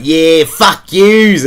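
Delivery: imitated junkie farewell. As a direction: drag it out like a stranger leaving the shop. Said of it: "just started walking out and was like".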